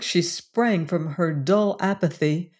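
ordinary delivery, nothing marked

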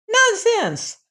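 put-on very high, dismissive old woman; high-pitched old-woman outburst; old mother crying out. A narrator's reading. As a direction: cry it out as an indignant old mother. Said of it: "cried his mother".

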